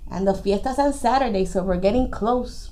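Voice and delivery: low, soft, quick aside; confiding aside. A narrator's reading. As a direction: drop low and confide it softly. Narrator's aside to listeners.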